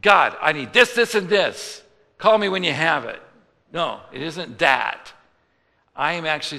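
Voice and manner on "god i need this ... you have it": loud, pushy list of demands; mock demanding prayer. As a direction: bark orders at God like a customer. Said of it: "I'm not coming just to".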